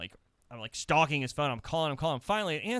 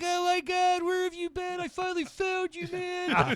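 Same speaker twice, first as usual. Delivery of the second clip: much higher, relieved gushing; excited friend. Retold or imitated. Stefan tackling him in relief, high excited voice. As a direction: gush like you finally found him. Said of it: "He's like".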